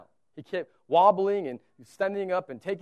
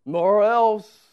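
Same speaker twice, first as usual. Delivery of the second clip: stern threat; 2 semitones higher, 9 dB louder, slower than usual. Pretend. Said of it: "It's not a".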